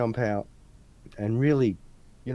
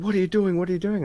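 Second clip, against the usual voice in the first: raised, higher-pitched mock demand; retold police challenge; 9 semitones higher, 5 dB louder, faster than usual. Two cops ambush a student walking home, raised mock demand. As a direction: fire it off insistently, like cops challenging a stranger.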